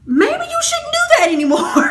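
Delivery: high, laughing Maybe you shouldn't do that anymore; mock advice. Advising the lying friend, high laughing mock advice. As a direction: tease her with mock-sweet advice. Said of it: "And I'm just like".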